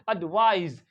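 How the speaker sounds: "एडवाइज" closing on a buzzing z; loud uhd-VAIZ for "एडवाइज"; Z ending. Shown as a demonstration. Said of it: "it becomes Z".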